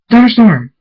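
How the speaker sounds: loud, high cry to a companion; screamed name. A narrator's reading. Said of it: "Draconis screams".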